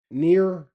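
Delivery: NEAR said loud with a hard final R; mock hillbilly "Near", G dropped; hard-R slur imitation. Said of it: "hit you with the hard R".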